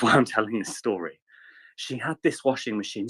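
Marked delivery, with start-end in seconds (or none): none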